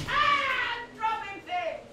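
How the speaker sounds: high, slow hammed-up fluster; a drama king losing it over nothing; mock panic. Fumbling streamer in hammed-up panic. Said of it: "Oh, I'm screaming".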